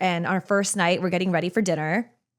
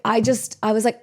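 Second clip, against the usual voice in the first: ordinary talk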